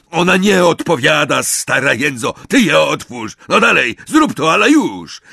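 loud, high, rude commands; bully them into doing it at once; angry snapping. A narrator's reading. Amisius orders the professor to open up, rudely impatient.

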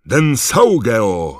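very loud, high, stretched incantation; roared spell. A narrator's reading. Malfoy fires back at Harry, roared spell. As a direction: roar the spell out. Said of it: "ryknął Malfoy".

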